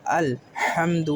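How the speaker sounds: الحمد drawn out very slowly; slow recitation. Shown as a demonstration. Teacher modelling الحمد slowly for learners. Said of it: "دال پیش دو".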